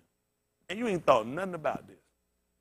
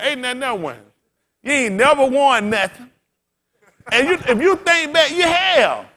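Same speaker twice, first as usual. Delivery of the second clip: much higher, much louder, shouting; preaching at full force; 12 semitones higher, 12 dB louder than usual. Acted. Preacher shouting at the congregation about complaining. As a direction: drive it home to the congregation.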